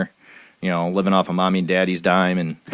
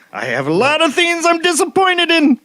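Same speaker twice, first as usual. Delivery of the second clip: pitched way up, half-joking gripe; mock high complaint; 3 dB louder than usual. Podcast guest joking about political gripes, high mock complaint. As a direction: squeak it out in jest.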